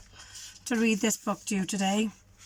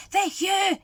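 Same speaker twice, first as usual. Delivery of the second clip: hissed; 8 semitones higher than usual. Acted. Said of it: "hissed the cat".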